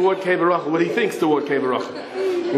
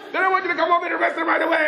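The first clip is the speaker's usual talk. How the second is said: high, rushed yell; frantic demand; 13 semitones higher, much faster than usual. Retold or imitated. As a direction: wrestle for the radio, voice pitched up. Patrolman demands arrest over radio.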